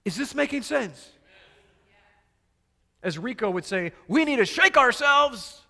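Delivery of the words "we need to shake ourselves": a bad put-on accent, higher and louder; Rico's accent. Preacher quoting Rico to the congregation in a bad accent. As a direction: mimic Rico's accent, badly. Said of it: "That was still a horrible accent".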